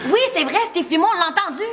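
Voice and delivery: high, agitated insistence; insistent confirmation. Acted. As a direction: back up the accusation urgently. Backs up the accusation, agitated. (confirmation insistante, voix aiguë)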